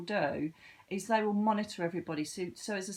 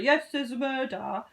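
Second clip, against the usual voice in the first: higher and louder, cocky claim; mocking her own certainty; 6 semitones higher, 7 dB louder than usual. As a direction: imitate your own overconfident self.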